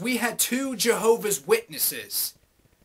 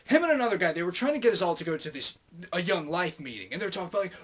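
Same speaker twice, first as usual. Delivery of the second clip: ordinary talk, nothing marked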